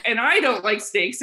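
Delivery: usual speaking voice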